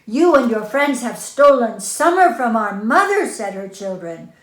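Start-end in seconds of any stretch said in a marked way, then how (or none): 0.0-3.3 loud, high angry charge; angry accusation. Acted. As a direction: accuse them all at once. Children accuse Coyote.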